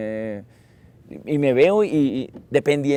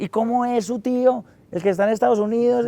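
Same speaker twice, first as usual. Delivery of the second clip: much higher grandma asking; imitating grandma; 10 semitones higher than usual. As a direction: coax the kid with a high question. Grandma prompting another story.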